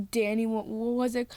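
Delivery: ordinary talk, nothing marked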